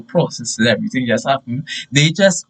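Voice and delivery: plain reading voice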